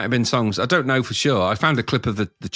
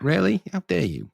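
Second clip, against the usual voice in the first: mock outrage; 8 semitones higher than usual. Real, not acted.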